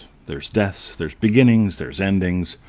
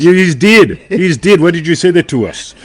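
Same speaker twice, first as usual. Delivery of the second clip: higher and louder exaggerated TV voice; mocking TV characters; 9 semitones higher, 8 dB louder than usual. Host parodying George Gently dialogue, high and loud. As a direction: ham up the show's characters for laughs. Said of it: "they talk about things like".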